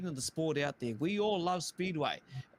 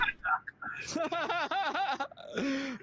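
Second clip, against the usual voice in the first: big high belly laugh; hearty laugh; 12 semitones higher than usual. Real, not acted. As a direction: burst out laughing. Big laugh at nightie joke.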